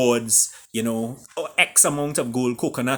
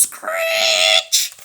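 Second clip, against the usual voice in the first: a loud mock screech of a horse; 5 semitones lower, 5 dB louder than usual. A narrator's reading. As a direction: screech like a horse pulling up hard. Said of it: "screeched her horse".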